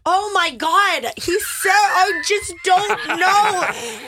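high, drawn-out comic wail; exasperated outburst. Real, not acted. Cohost asked about the word ornery, exasperated wail.

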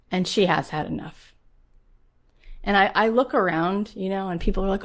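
usual speaking voice

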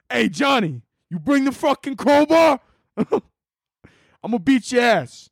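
high-pitched mob heavy making threats; cartoon wiseguy calling on his partner; Italian mobster. Mobster calling for the crowbar, hammed-up menace. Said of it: "big-ass Italian mobsters".

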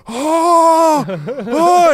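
put-on high, loud toddler gasps; excited kid. Son presses hands on the window, loud excited cries. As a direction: shout like a thrilled child.

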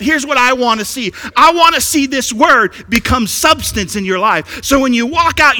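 high, loud, fervent appeal; full-force preaching. Acted. Preacher urges congregation to see Jesus, full-force preaching. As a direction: press each point home at volume.

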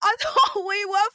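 high, drawn-out wail; hammed-up crying through laughter; mock sobbing. Rejected auditioner wailing to a friend in casting, mock-tearful.

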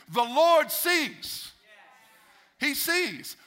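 high, loud, very slow proclamation; preaching at full force. Acted. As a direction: proclaim it, dragging out "Lord". Preacher's climax.

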